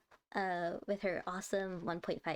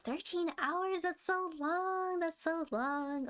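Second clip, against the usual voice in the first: amazed disbelief; 7 semitones higher, slower than usual. Real, not acted. Hearing about a 13-hour stream.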